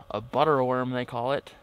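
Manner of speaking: ordinary talk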